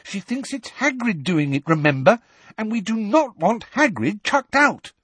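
worked-up rant; Hermione agitated. A narrator's reading. Hermione upset.

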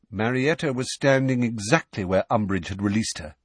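ordinary delivery, nothing marked

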